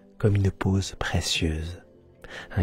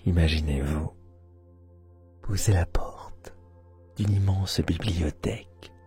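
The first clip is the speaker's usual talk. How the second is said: guided meditation; 3 semitones lower, 4 dB quieter than usual. Acted. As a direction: guide them into sleep, half whispering.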